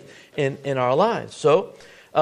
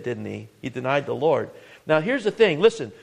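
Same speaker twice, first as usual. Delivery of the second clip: ordinary talk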